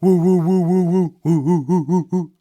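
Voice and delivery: high, loud hooting woos; imitated hooting. Shown as a demonstration. Teacher demonstrating a muddy bass frequency, loud high hooting. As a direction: hoot like an owl in pulsing bursts. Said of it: "that's what those frequencies sound like".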